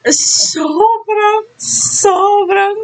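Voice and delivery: high stretched-out gushing. Real, not acted. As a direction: swoon over how handsome they were. Gushing over idols' looks.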